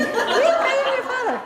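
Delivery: villain's line in a very high, loud squeak; Minnie Mouse. Shown as a demonstration. Coach mixes up the two exercises, Minnie Mouse voice. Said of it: "now do the Minnie Mouse one again".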